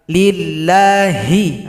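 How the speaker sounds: Lillahi with the final ha drawn out, slow; heavy ha. Shown as a demonstration. Teacher shows the heavy ha in Lillahi, slow. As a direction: stretch Lillahi slowly. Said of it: "ini dia pada lafzul jalalah".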